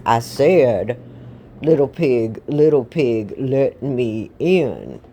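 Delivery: slow, heavy, insistent wolf voice; big bad wolf losing patience; menacing wolf. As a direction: repeat it as a drawn-out threat. Wolf repeats demand, more menacing.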